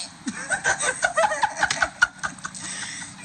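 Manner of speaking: a burst of breathless high laughter; high airy laugh. Real, not acted. Laughs at own story.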